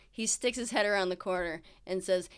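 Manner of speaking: ordinary talk, nothing marked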